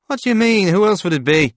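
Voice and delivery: insistent protest. A narrator's reading. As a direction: push back as if it were obvious. Father arguing with doubting son.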